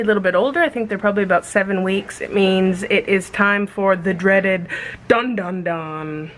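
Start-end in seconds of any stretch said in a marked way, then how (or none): none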